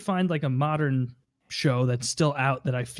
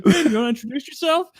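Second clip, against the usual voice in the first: higher, fast put-on voice; Jerry impression; 10 semitones higher, 4 dB louder, faster than usual. Friend repeating Jerry's line faster. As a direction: rush the sly question. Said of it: "He's like".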